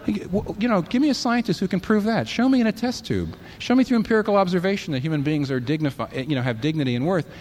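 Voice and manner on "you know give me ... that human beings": higher-pitched demand for proof; lightly acted skeptic calling the bluff; skeptical challenge.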